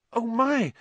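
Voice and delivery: high-pitched horrified gasp. A narrator's reading. Hermione sees Lupin begin to shake, gasping. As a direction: gasp it out in alarm. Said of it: "Hermione gasped".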